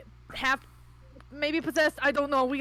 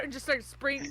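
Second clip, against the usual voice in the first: normal talking voice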